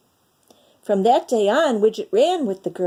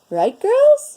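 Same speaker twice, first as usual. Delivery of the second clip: much higher, louder, coaxing the cats; high bright call to the cats; 7 dB louder than usual. Acted. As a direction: sing it up to the cats for agreement. Missus Diggs asks the cats.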